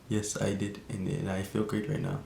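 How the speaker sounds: soft imitation of an Indian accent. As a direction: quietly mimic the stoned friend's Indian accent. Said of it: "I ain't even wanna use the accent".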